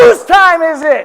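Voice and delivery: high, loud, slow rally call; rally shout. Real, not acted. Speaker shouting to the crowd for Dave, at full volume. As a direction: roar it out to fire up the crowd.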